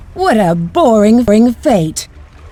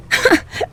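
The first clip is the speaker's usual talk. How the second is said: high mocking cackle; 11 semitones higher, 5 dB quieter than usual. Villain mocks offer of help.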